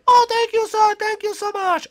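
very high-pitched little bunny voice; squeaky grateful bunny. Acted. Bunny thanking the person who picked it, squeaky and grateful.

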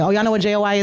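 ordinary delivery

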